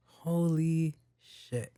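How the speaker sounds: drawn out very slowly in mock amazement; slow mock awe. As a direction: savour it, faking amazement.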